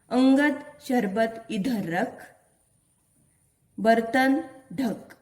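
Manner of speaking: each word spaced out slowly for the class; slow reading aloud. Shown as a demonstration. Teacher reading a sentence slowly to her pupils. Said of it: "वाक्यों का वाचन मैं करने वाली हूँ".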